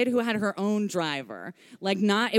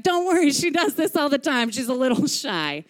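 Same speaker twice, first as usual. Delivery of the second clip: higher, louder hurried reassurance; grandpa calling back; 5 semitones higher, 4 dB louder than usual. Retold or imitated. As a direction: toss it back while running off, mimicking grandpa. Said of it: "as he's sprinting down the hall".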